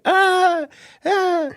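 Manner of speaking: very high mock whine; imitated whining moan. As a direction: imitate drawn-out, high-pitched whining cries. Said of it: "like, whining".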